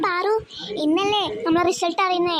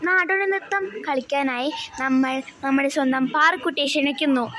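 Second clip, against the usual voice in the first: stage announcement; 3 semitones lower than usual. Acted.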